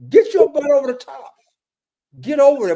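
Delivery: high, loud coach's bark at a player; yelling orders. Retold or imitated. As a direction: shout it like a coach on the sideline.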